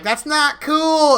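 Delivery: drawn-out high, loud chorus of disapproval; high, loud, slow mock outcry; mock group protest. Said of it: "everybody was like".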